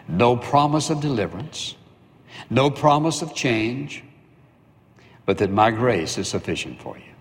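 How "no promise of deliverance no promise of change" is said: normal talking voice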